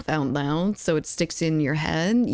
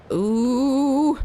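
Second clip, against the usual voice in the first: excited ooh; 7 semitones higher, 4 dB louder than usual. Real, not acted. Reacting to game moment.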